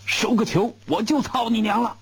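high, cursing bellow; the general explodes at his guard; furious shout. A narrator's reading. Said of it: "大喊一声".

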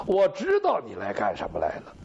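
low menacing growl, savouring what he knows; knowing, menacing king. Acted. The king, bow drawn, to the minister: deep ominous threat. King answers his own question.

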